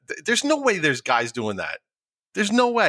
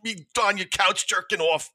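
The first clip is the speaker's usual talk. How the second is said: higher-pitched, put-on indignant voice; Bobby's mock outrage; 6 semitones higher than usual. Friend hearing himself discussed on the podcast, mock outrage. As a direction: imitate an offended friend, hammed up. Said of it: "He's gonna be so mad".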